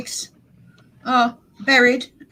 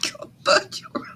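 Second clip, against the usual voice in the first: whisper; 5 dB quieter than usual. Real, not acted. Whispered teasing.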